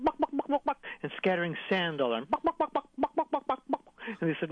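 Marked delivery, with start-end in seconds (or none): 0.0-0.8 rapid high-pitched chicken clucks; chicken clucking. Retold or imitated. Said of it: "clicking his tongue like a chicken". 2.3-3.8 rapid high-pitched chicken clucks; chicken clucking. Retold or imitated. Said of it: "clicking his tongue like a chicken".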